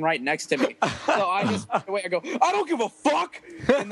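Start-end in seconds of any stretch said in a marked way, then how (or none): 2.2-3.4 high-pitched yell into the mic. Retold or imitated. Singer dismisses sick bandmate into the live mic, mock yell. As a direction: imitate blurting it out over the mic. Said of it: "it makes me louder".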